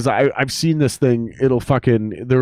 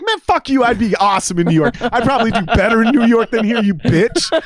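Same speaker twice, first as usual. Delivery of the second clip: very high, loud mock yell; mock angry teen retort; teen mouthing off; 11 semitones higher, 6 dB louder than usual. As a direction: snap back like a cocky teenager.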